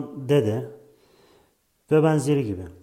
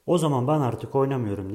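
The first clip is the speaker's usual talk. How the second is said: plain reading voice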